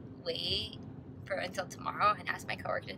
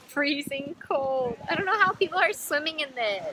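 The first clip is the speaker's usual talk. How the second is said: excited exclaim; 5 semitones higher, 7 dB louder than usual. Real, not acted. Feeling icy water, exclaiming.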